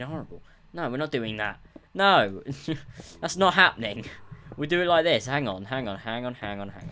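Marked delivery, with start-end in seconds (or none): 1.9-2.4 high, sharp shout of no; emphatic loud outburst; loud refusal. Real, not acted. Refusing bad build. 3.2-5.2 loud, high exclamation; raised, insistent voice; emphatic refusal. Real, not acted. Refusing bad build.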